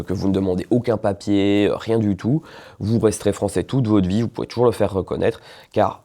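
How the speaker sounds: usual speaking voice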